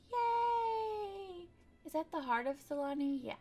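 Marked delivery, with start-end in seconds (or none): 0.1-1.5 cheer. Real, not acted. Cheering a placed item.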